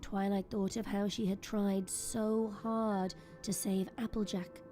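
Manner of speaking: usual speaking voice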